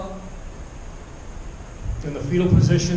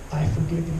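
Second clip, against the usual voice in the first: softer, lower voice of God; 5 semitones lower, 7 dB quieter, faster than usual. Acted. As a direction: answer gently and quietly. God's voice answering him.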